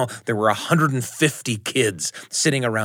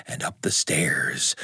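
whispering; 7 semitones lower, 5 dB quieter than usual. A narrator's reading. Creeping ghost in story.

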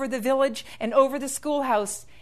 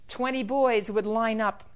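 ordinary delivery

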